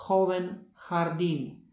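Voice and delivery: "joven jardín" with a strong rasped H; teacher's example words; strong jota. Shown as a demonstration. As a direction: push the jota out hard. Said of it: "Jota wymawiana jest jak mocne H".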